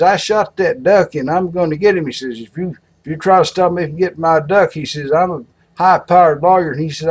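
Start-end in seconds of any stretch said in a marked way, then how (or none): 0.0-2.1 insistent lawyer. Acted. Lawyer insists in joke quarrel. 2.4-4.7 higher, mock-threatening lawyer; playfully acted legal threat. Lawyer threatens farmer in joke. 5.1-6.7 higher, self-important lawyer; mock bragging with raised pitch; boastful lawyer.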